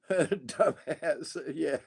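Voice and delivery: normal talking voice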